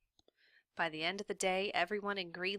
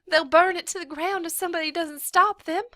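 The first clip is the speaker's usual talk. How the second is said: much higher, loud frantic urging; urgent plea; 11 semitones higher, 10 dB louder than usual. A narrator's reading. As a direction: beg for help.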